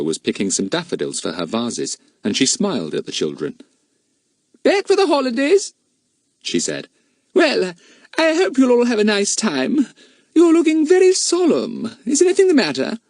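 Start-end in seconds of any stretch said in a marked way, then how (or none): 4.6-13.0 high, pleasant grown-up lady; mother's voice. A narrator's reading. Fatty's mother greeting the children, pleasant and high.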